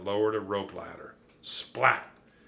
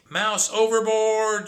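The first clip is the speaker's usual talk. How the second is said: high drawn-out shriek; 12 semitones higher, 7 dB louder, slower than usual. Acted. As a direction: shriek it across the deck. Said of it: "she cr-, shrieked".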